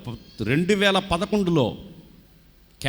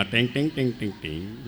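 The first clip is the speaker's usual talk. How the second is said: mock bell ringing; 7 semitones lower, 5 dB quieter than usual. Mimicking a bell or strum.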